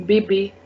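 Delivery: "bebe" said bay-bay; accented E sound. Shown as a demonstration. Teacher giving an accent aigu example, "bebe" as bay-bay. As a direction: give "bebe" an ay in both syllables. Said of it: "A sound you need to give".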